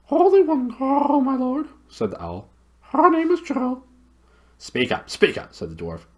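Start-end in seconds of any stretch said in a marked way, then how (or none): 0.1-3.8 very high owl voice, hooted loudly; high, loud owl call; loud hooting owl. Acted. 4.6-5.5 lightly voiced cranky old dwarf; a hint of a crotchety dwarf; touch of a grumpy dwarf; grumpy deaf dwarf. Dwarf impatient.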